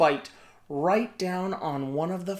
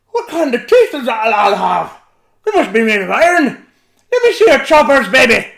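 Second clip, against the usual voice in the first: much louder, higher giant shout; toothless giant; 6 semitones higher, 14 dB louder than usual. A narrator's reading. Giant asking baby Finn to show his teeth. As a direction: demand to see teeth.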